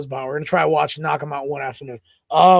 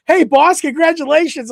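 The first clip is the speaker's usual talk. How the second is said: higher, louder cheery friend voice; chirpy congratulations like Nate; upbeat friend on the phone; imitating Nate; 9 semitones higher, 4 dB louder than usual. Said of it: "Nate's like".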